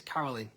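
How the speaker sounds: "Caroline" ending like "lin"; Caroline as Carolyn. Shown as a demonstration. Singer guessing the viewer's name, "Caroline" as "Carolyn". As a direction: say "Caroline" with a short final i. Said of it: "I'm guessing it's Caroline".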